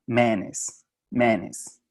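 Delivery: "manes" in two syllables, MAN-ez. Shown as a demonstration. Teacher demos the Southern two-syllable "manes" for the class. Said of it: "dos sílabas y lo pronuncian como".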